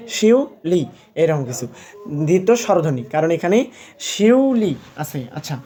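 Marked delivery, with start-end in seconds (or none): 0.1-0.9 শিউলি split as শিউ, then লি, higher pitched; two separate beats. Shown as a demonstration. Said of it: "দুইবার উচ্চারণ হবে". 4.0-4.7 শিউলি drawn out as শিউ, then লি; two separate beats. Shown as a demonstration. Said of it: "দুইবার উচ্চারণ হবে".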